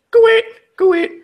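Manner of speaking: very high chirping cry; squeaky bird call. Acted.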